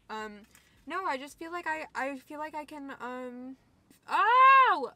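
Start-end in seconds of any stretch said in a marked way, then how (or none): 4.1-4.9 loud, high-pitched "oh!" of dismay; sudden loud yelp as a tear comes; high wail of surprise; startled tearful cry. Real, not acted. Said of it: "Another tear".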